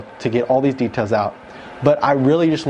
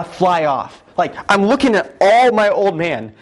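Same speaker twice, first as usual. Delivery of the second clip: usual speaking voice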